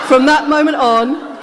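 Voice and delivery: amused, beaming; laughing. Real, not acted. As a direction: carry the laugh into the line. Still laughing after the punchline.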